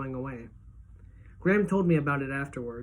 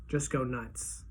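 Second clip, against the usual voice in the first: softer repeat, almost to himself; soft echo; 4 semitones lower, 7 dB quieter, much slower than usual. A narrator's reading. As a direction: echo it under the breath. Narrator echoes the phrase.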